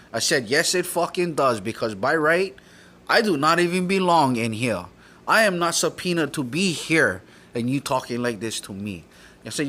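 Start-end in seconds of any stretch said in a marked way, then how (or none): 0.5-9.0 loud, insistent retold objection; indignant pushback at the judge; heated retort. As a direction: argue back forcefully, voice pitched up.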